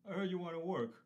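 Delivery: boss's New York talk. Retold or imitated. Guest imitating his boss in 1985 Queens, New York boss voice. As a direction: rattle it off like the old Queens foreman. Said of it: "'cause that's how he talked".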